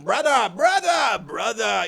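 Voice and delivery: mock solemn marine. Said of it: "the whole, like".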